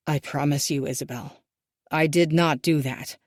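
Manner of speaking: low, lightly voiced tearful father; tearful pleading. A narrator's reading. Father swearing to his daughter, lightly tearful. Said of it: "tears streaming down his face".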